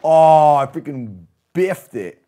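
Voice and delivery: loud, slow groan of defeat; frustrated yell. Real, not acted. Game fail outburst.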